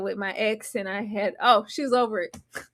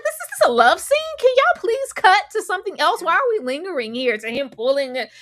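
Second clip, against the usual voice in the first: exasperated; 7 semitones higher, 4 dB louder than usual. Acted. Complaining at the scene.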